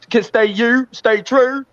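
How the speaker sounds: high put-on Baltimore voice; Baltimore people voice. Quoting Baltimore people to Monty, in a high put-on voice. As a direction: ham up the hometown crowd, pitched high. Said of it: "My Baltimore people say".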